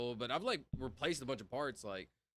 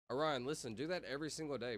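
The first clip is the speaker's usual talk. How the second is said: normal talking voice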